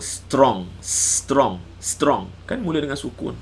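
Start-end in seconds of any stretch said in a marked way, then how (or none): 0.0-2.3 strong with the s and t cluster said together, no vowel before; English pronunciation demo. Shown as a demonstration. Teacher showing students an English word starting with sukun, demonstrating. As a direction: say strong cleanly three times, starting on the consonant. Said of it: "dalam bahasa Inggeris, sebut".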